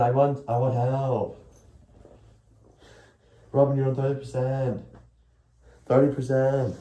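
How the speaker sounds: put-on Chinese accent with falling phrase ends; Stephen He Chinese accent. Shown as a demonstration. Phone pleading with its owner to unplug, mock Chinese accent. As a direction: do a hammed-up Chinese accent, drawn out. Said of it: "The Chinese accent goes. Stephen He does that".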